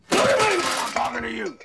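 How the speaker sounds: husband screaming at his wife to face him; furious shout. Acted. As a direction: explode in a rage at her.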